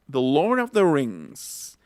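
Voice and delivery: very slow, every word stretched out; very slow model. Shown as a demonstration. Teacher reads it out very slowly for students. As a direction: drag it out word by word. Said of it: "Otra vez".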